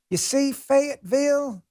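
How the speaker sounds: slow, high-pitched mock Southern drawl; fake Southern accent. Host mocking a politician's fake accent, exaggerated Southern drawl. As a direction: drag it out in a hammed-up Southern accent. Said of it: "worst fake Southern accent".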